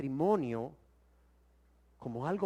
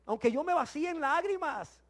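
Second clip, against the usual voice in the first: pleading complainer; 11 semitones higher, 3 dB louder than usual. Retold or imitated. Preacher voicing the unfaithful husband questioning God, pleading. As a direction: beg God why, hurt. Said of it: "Mas diréis".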